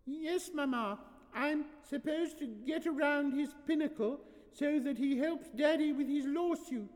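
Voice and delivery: put-on very high little-girl voice; young daughter. Daughter answering her mama, high childish recital.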